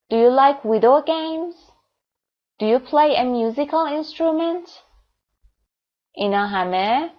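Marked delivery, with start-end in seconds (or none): 0.1-1.5 yes-no question rising through "video games"; rising intonation. Shown as a demonstration. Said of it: "rising intonation داشتن". 2.6-4.7 yes-no question rising through "musical instrument"; rising intonation. Shown as a demonstration. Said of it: "rising intonation داشتن".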